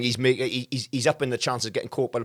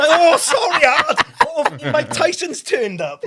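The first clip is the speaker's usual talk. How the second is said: sarcastic mock apology; 12 semitones higher, 7 dB louder, slower than usual. Sarcastic reply to troublemaker.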